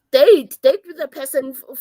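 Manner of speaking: normal talking voice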